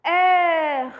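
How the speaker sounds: heure with a throaty rolled French R; French heure, R gargled at the back. Shown as a demonstration. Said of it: "you have to feel here the vibration".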